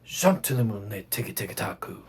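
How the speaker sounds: soft, low murmured chant; piano. Shown as a demonstration. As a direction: chant it softly, dropping the pitch low.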